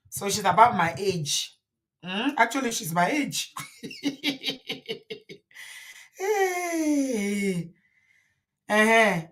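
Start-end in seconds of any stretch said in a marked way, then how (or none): 3.6-5.3 soft breathy laugh at herself. Real, not acted. Vlogger laughing at being the wife's age, soft laugh. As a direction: laugh it off quietly.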